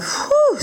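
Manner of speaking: very high relieved whew; squeaky sigh of relief; dog's relieved whew. Acted. Dog relieved after the danger, high whew. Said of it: "said the dog".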